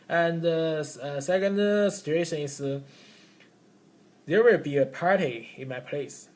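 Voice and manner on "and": usual speaking voice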